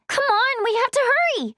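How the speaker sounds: high, insistent urging; pressing and high; urgent plea. Acted. Character urges others to hurry.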